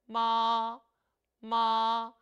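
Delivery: Mandarin first tone, sustained and level; first tone demo. Shown as a demonstration. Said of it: "일 성은 높은 음을 길게 빼주죠".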